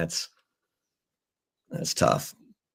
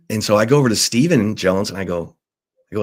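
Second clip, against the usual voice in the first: ordinary delivery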